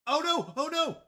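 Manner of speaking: high, loud panicked oh no; alarmed cry. Real, not acted. Streamer panicking over a stream mistake, loud alarmed cry. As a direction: yelp it in real alarm.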